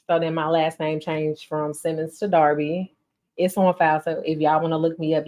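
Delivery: ordinary talk, nothing marked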